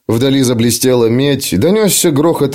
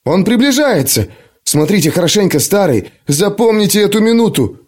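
lightly raised, eager voice; a touch of awe and excitement; excited urging; 8 semitones higher than usual. Acted. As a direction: urge the old man to look. Tom excited.

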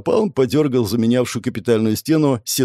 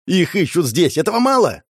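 higher, indignant retort; flaring up; 6 semitones higher than usual. A narrator's reading. Framed man answers a doubting friend, flaring up. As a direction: snap back, climbing in pitch. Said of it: "взбеленился Игорь".

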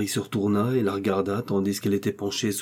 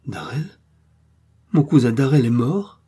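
shocked cry; 4 semitones higher, 5 dB louder than usual. A narrator's reading. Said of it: "s'écria-t-elle".

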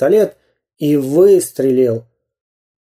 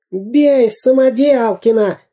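higher, slow desperate yell; wailing spy; 9 semitones higher, slower than usual. A narrator's reading. Dyrka lying on the pavement yells to beat Samodelkin, wailing. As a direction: howl from the pavement. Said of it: "голосил Дырка".

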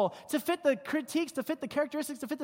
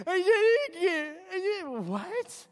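high, blubbering fake sobs; mock crying; 8 semitones higher, slower than usual. Teen girl crying at her mother, hammed-up sobbing. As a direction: sob it out like a hysterical teen. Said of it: "girls just lose all pronunciation".

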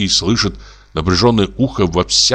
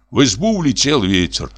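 ordinary delivery, nothing marked

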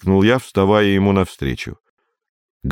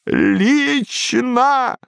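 put-on high, creaky old man's voice; 13 semitones higher than usual. A narrator's reading. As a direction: creak it out like a frail old man. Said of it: "проскрипел старик".

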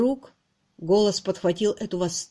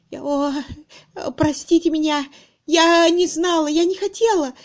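high, faltering, flustered apology; distraught apology; 8 semitones higher, 5 dB louder than usual. A narrator's reading. Miss Twitterton caught intruding, distraught. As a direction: stumble into a loud plea for forgiveness.

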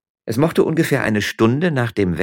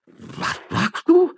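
very high, slow, incredulous question; old man's affronted yelp; startled old magistrate; 4 dB louder, much slower than usual. Acted. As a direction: squeak it out in drawn-out disbelief. Old magistrate reacts to threat.

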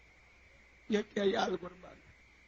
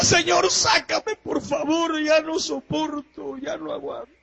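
pleading cry; 6 semitones higher, 5 dB louder, slower than usual. A narrator's reading. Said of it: "le gritaba".

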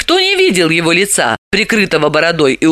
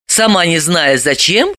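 ordinary delivery, nothing marked

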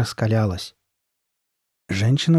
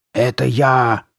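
louder raspy monster growl; Карли Бет; 2 semitones higher, 5 dB louder, much slower than usual. A narrator's reading. Masked girl revealing herself to Sabrina, growling. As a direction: growl it out, drawn long. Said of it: "прорычала Карли Бет".

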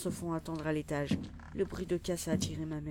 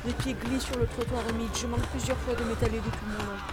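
narration tendue, voix plus haute; récit haletant de fuite; 5 semitones higher, 4 dB louder than usual. A narrator's reading. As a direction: raconter une course éperdue, dans l'urgence. Récit de la course éperdue. (récit d'action sous tension, ton élevé)

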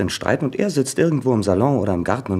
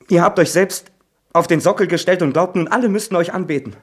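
higher and louder, bitter rising tirade; furious outburst; 7 semitones higher, 5 dB louder than usual. Acted. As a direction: lash out, building in rage. Son erupts against mother, Trigorin.

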